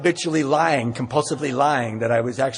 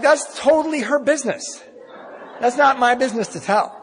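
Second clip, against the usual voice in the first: self-righteous mock; 10 semitones higher, 3 dB louder than usual. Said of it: "I'm like".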